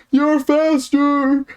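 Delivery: very high, loud, stretched-out squeal; imitating panicked kid. Small kid being outrun by a tall camper, mock-panicked squeal. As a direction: wail it like a kid being caught.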